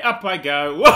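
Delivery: normal talking voice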